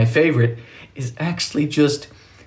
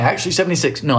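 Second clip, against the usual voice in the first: usual speaking voice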